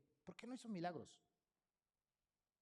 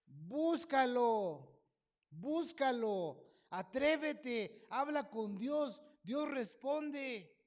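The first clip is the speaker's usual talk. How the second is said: very slow, drawn-out, loud urging; fervent exhortation; 6 semitones higher, 7 dB louder, much slower than usual. Acted. Preacher urging his listeners to seek God, slow and fervent. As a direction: plead with them to seek God.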